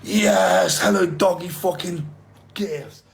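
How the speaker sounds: ordinary talk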